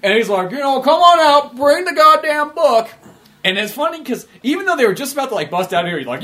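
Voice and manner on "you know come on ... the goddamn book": high, loud, slow hollering villain; megaphone call-out. Retold or imitated. Gary Oldman's villain calls the heroes out, loud megaphone holler. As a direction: bellow it out like through a megaphone.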